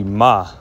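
'ma' with an open final ah; suffix as written. Shown as a demonstration. Teacher shows learners the masculine suffix, sounded as 'ma'. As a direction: say 'ma', consonant first, then open vowel. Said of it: "Now, the masculine".

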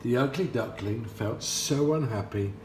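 usual speaking voice

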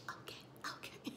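barely voiced imitated acquiescence; chastened whisper. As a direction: whisper it meekly, as if just corrected. Imitating Serena's chastened look.

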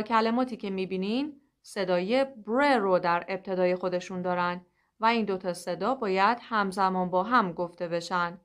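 normal talking voice

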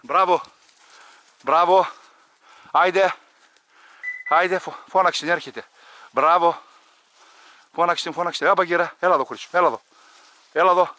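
loud praise and calls to the dogs; calling the dogs. Real, not acted. Hunter loudly praising his dog and calling it back. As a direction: cheer the dog on and call it back.

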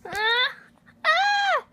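high squealing mock panic shrieks; pretend screaming. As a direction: shriek between giggles. Dog jumps on the character.

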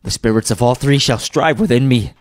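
loud, fervent thanks; impassioned praise, rising to high and loud; fluttered and glowing. Acted. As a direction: overcome with gratitude, building to a cry. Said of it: "his broken voice would scarcely answer".

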